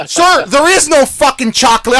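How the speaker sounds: mock outraged customer yell; angry lady shout. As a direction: snap back as the fed-up lady. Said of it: "And she goes".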